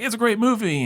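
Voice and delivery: very high mock gushing; exaggerated high-pitched praise; mimicked fans. Mimics friends praising the film.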